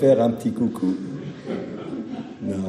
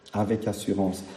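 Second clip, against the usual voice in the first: "avec assurance" repeated much softer, like an echo; 3 semitones lower, 9 dB quieter than usual. Real, not acted. Preacher echoing his key phrase to the congregation, softly. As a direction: drop "avec assurance" to a hush.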